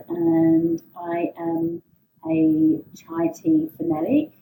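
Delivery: usual speaking voice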